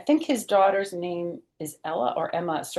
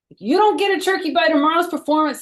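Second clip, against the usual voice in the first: high, loud and fast threat; fast raised-voice warning; ultimatum; 10 semitones higher, 8 dB louder, faster than usual. A narrator's reading. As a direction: snap out an ultimatum to the class. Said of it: "said Francine".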